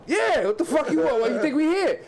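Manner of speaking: put-on a touch of a tough older kid; older dude voice. Older dudes voiced in story.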